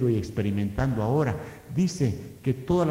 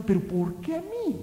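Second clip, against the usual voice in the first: plaintive why me; 13 semitones higher than usual. Retold or imitated. Believer's complaint voiced.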